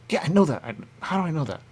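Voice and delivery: mock-puzzled quote; puzzled recognition. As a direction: sound surprised at your own knowing.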